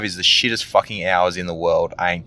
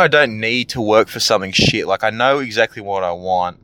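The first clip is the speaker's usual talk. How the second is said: usual speaking voice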